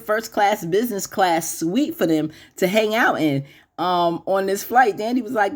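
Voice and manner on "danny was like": normal talking voice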